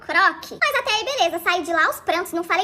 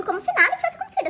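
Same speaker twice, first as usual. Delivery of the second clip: higher, fast, laughing at how he acted; mocking disbelief; 7 semitones higher, faster than usual. Retelling how her ex showed up unbothered, mocking and laughing. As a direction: repeat it with a scoffing laugh.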